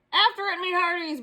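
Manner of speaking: high, firm captain's order; captain commanding his crew; pirate command. Acted. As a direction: order the crew after the ball. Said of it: "Braidbeard commanded".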